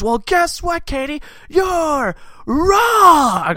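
high taunt building to a drawn-out shout; mock yelling. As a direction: imitate a friend yelling her down, stretching the last word. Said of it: "just starts yelling it like that".

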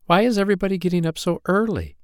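put-on high, quick child-fairy question; curious little fairy. A narrator's reading. Poppy Pink asking the older fairies, high and curious.

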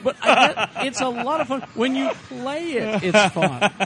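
high, loud, talking through laughter; laughing. Real, not acted. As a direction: laugh the words out. Laughing while echoing a joke.

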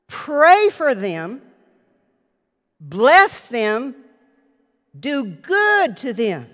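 preacher driving the commands home; preaching cadence. Acted. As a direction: lay each command down slowly. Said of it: "Let me go over it again".

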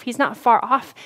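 ordinary talk

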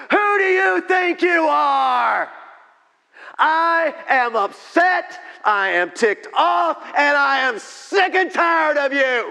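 drawn-out, high, full-volume outburst at God; acted screaming rant; furious shouting. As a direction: yell it slowly, enraged and fed up. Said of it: "He was angry".

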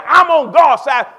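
loud, slow declaration; shouted bold defiance; defiant shout. Acted. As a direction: shout it out with conviction. David declaring defiance of Goliath.

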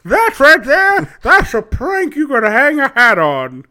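put-on high crotchety old-timer voice; old prospector. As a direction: scold the whippersnappers like a grizzled geezer. Grumpy old-timer scolding youngsters.

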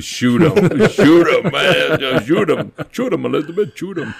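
high, loud, laughing through the words; laughing repeat. Real, not acted. Retelling the old lady's order while laughing. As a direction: laugh while you quote it.

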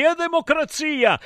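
high-pitched mock cry; high theatrical exclamation; shouted. A narrator's reading. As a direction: cry it out like the gods. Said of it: "gridarono gli dei".

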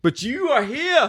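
rally the troops like a Scottish king; hammed-up war speech; rousing movie speech.

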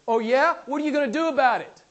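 hammed-up defiance; stern retort. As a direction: challenge him like a gruff Scottish preacher. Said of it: "very sternly".

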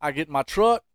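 normal talking voice

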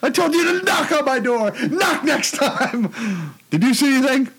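high, scolding movie villain; high put-on villain voice. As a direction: snap at them like a whiny villain. Quoting Spaceballs villain scene.

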